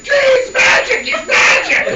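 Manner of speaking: very high, loud, drawn-out shriek; mock panicked shriek. Dimitri sees Rasputin survive, panicked shrieking. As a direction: scream it in hammed-up terror.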